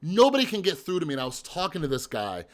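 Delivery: ordinary delivery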